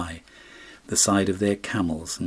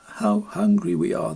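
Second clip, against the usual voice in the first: put-on much higher child voice, plaintive; hungry little boys; 12 semitones higher than usual. A narrator's reading. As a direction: voice two small boys under a bridge. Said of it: "they said".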